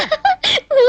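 short high laugh. Acted. Girl laughs at visitor's complaint, short high laugh. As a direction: burst into a brief high-pitched laugh. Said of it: "ఏమిటా నవ్వు".

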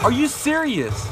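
high, slowed disbelief; can't believe the shots missed; incredulous. Real, not acted. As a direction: stretch it out in stunned disbelief. Just killed despite shooting first.

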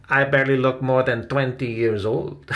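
lightly voiced jokey character; joking. A narrator's reading. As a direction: deliver a playful boast. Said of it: "joked Salvador".

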